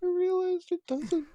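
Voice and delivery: put-on high girly voice for the sister. Sister voiced in read story.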